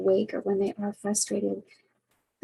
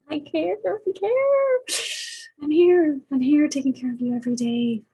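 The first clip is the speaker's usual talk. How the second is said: louder insistence on being here every day; exasperated pleading; 6 semitones higher, 4 dB louder than usual. Retold or imitated. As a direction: beg to be believed, straining up high. Quarrel with mom, pleading back.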